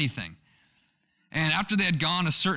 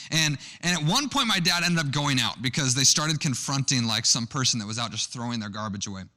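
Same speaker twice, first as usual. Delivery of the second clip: ordinary delivery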